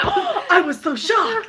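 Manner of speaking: high, drawn-out fake astonishment; mock shock. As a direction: overplay the shock with laughter. Mocks own shocked reaction, laughing.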